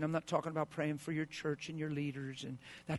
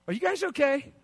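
loud check-in; 11 semitones higher, 10 dB louder than usual. Real, not acted.

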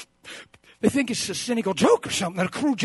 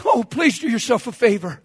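usual speaking voice

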